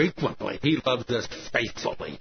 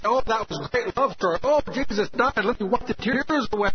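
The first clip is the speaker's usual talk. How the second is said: high-pitched mock gushing; mock sentimental; 7 semitones higher than usual. Preacher voices a tearful hearer of the cross story, mock sentimental. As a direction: gush as if moved by a love story. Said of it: "You say".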